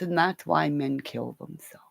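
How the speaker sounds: whispered, dropping very soft; grim whisper. Real, not acted. Explaining to viewers why men hold in secrets, whispered. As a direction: let it fall to a dark whisper.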